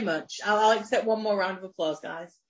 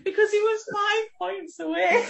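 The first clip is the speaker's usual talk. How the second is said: laughing; 10 semitones higher than usual. Real, not acted. Laughing at Chris losing.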